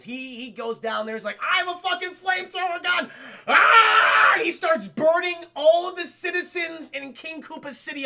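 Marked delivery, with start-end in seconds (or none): 1.4-3.1 high, hammed-up battle yell; manic villain shout. Koopa attacking with a flamethrower, manic shout. 3.5-4.3 long, loud high yell; mock battle scream. Koopa firing his flamethrower, long mock battle scream. 4.3-8.0 high, loud frenzied retelling; shouted storytelling. Retold or imitated. Telling chat of the burning city, frenzied shout.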